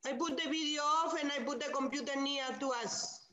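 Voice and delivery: very quiet and fast; murmured too softly to hear; barely audible. Real, not acted. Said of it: "Your voice is very low".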